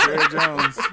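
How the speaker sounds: laughing through the name; cracking up mid-word. Real, not acted. Laughing at absurd joke.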